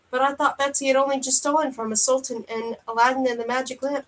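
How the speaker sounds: ordinary delivery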